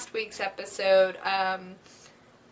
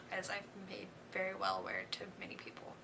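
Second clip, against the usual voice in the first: fast, breathy whispered aside; 3 semitones lower, 19 dB quieter, faster than usual. Real, not acted. Fan confiding to viewers in a quick whisper. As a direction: toss it off under your breath.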